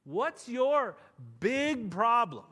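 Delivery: slow, loud preaching emphasis. Acted. Preaching at full force.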